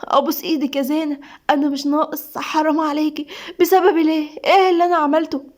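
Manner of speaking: pleading, crushed protest; tearful anguish. Acted. Husband begging wife not to blame him, tearful anguish. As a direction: beg her as if near tears. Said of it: "سيف بدموع وقهرة".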